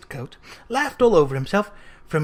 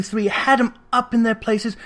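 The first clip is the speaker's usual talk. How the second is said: plain reading voice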